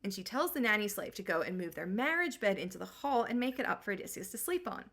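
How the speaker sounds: normal talking voice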